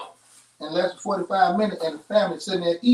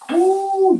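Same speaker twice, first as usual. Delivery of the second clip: delighted ooh; 12 semitones higher, 7 dB louder than usual. Real, not acted. Smelling the beef cooking.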